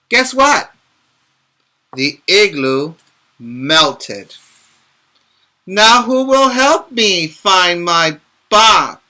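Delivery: lively storybook read-aloud; picture book voice for young listeners; reading to children. Acted. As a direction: read it brightly to little kids.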